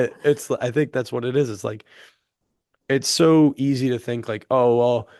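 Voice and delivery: usual speaking voice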